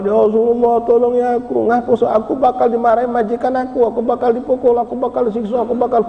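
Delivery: put-on high, pleading child's voice near tears; high tearful plea of a scared slave boy; crying plea. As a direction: beg for help as a frightened crying boy. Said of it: "Nangis hebat".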